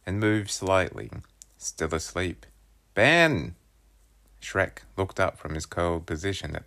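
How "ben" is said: yell. A narrator's reading. Yelling at sleeping brother.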